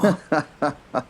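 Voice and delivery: high chuckle in character; laughing. A narrator's reading. Knight laughs after apologising to the herald, high chuckle. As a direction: break into a knight's high giggle. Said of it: "started laughing once more".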